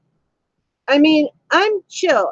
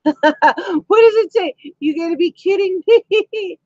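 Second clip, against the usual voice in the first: disbelief; 5 semitones higher than usual. Real, not acted. Reading chat comment in disbelief.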